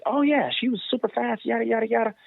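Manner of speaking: high, gushing coach voice; enthusiastic coaches. Retold or imitated. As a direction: mimic coaches raving about her. Other coaches praising her.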